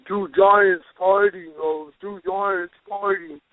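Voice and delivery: put-on slow, slurred drunk pleading. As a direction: slur it out like you're wasted. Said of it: "in my own drunk voice".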